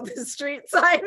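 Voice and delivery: breaking into a laugh; slow, laughing mid-phrase; amused. Real, not acted. Laughs at own cluelessness.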